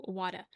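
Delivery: "water" as wadda, r dropped; mom's New York water. Retold or imitated. Streamer imitates mom's occasional slip, New York wadda. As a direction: say "water" like wadda, open uh ending. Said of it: "she'll do water".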